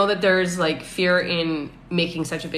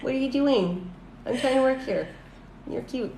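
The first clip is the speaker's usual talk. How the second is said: talking to a pet; 7 semitones higher, 4 dB quieter than usual. Real, not acted.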